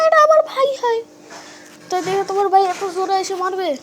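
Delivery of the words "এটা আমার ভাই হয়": put-on much higher, louder, squeaky girl voice accusing; high girlish voice. Samira accuses Raj of taking her flowers, high squeaky girl voice. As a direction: play the little sister catching a thief. Girl character introduces brother.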